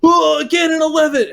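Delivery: high, excited shout of recognition; [name]. Retold or imitated. Streamer spotting a regular viewer in person, excited greeting. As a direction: burst out with a delighted greeting.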